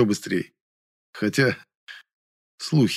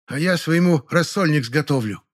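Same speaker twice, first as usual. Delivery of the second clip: high, chirpy woman's kitchen voice; cheerful housewife; 6 semitones higher than usual. A narrator's reading. Neighbour boasts of her cooking, cheerful. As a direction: play a cheerful neighbour in a raised pitch. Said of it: "жизнерадостно прозвучал голос Ложкиной".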